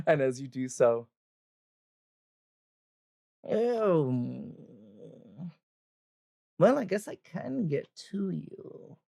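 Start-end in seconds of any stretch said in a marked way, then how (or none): 3.5-9.0 high, drawn-out reluctant greeting; put-on older woman's voice, sighing; old adventurer woman. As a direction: grudgingly turn to the caller.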